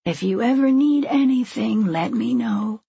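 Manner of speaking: lightly voiced kindly woman; kindly pantry worker. A narrator's reading. Pantry worker quietly offers help.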